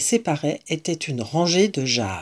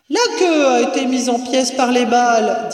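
calling down; 6 semitones higher, 3 dB louder than usual. A narrator's reading. Voice from upstairs answers.

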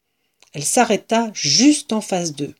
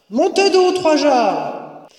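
high shouted order from a distance; calling down; 9 semitones higher, 3 dB louder, slower than usual. A narrator's reading. Mistress answers from atop the stairs, calling down. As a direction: call it down the stairs.

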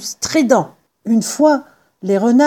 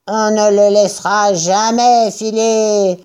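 declaring. A narrator's reading. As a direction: echo the vow in a second farmer's voice. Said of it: "déclara Buns". (déclaration traînante de personnage)

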